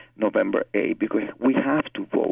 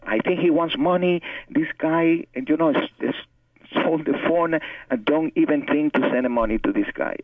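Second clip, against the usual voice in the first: higher, urgent warning to his partner; alarmed warning; 5 semitones higher than usual. Retold or imitated. Warning her partner the finder wants money, alarmed and higher. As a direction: sound the alarm, convinced it's a scam.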